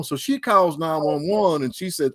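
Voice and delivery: normal talking voice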